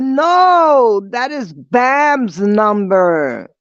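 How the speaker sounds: high, loud no, then a slow correction; exasperated correction. Retold or imitated. Speaker corrects sister about Bam's number, loud and exasperated. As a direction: slam down the no, then spell it out.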